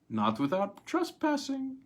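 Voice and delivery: a lightly voiced slick agent; sly high retort. A narrator's reading. Agent to buyer, teasing retort.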